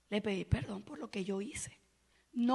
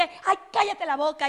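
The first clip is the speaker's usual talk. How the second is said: louder mock snap of irritation; imitating an angry yell; 5 semitones higher, 9 dB louder than usual. A preacher acts out an angry outburst: loud mock shout. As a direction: mimic someone telling another to shut up. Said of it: "Cuando una persona que grita".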